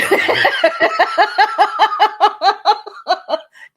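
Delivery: long loud cackle; loud laughing fit. Real, not acted. Host laughing at her own jab, long and loud. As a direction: crack up at your own joke.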